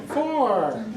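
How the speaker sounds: high squawky parrot answer. Acted. Parrot answers golf question.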